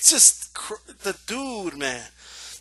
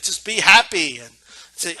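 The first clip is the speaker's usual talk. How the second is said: usual speaking voice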